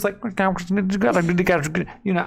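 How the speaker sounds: garbled phone noise. Retold or imitated. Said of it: "it was just garbling".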